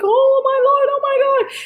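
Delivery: sudden very high alarmed exclamation; panicked cry. Retold or imitated. Missile coming right at them.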